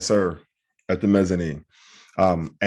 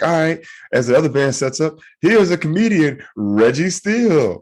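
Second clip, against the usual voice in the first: higher-pitched mock MC introduction; stage announcer; 7 semitones higher than usual. Emcee introducing a comedian between bands, announcer voice. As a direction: announce the act, stretching the name.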